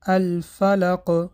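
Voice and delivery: الفلق slow, with the final ق bounced hard; slow down and echo the ق at the end of الفلق; strong qalqala stop. Shown as a demonstration. Teacher recites الفلق for students with strong qalqala at the pause.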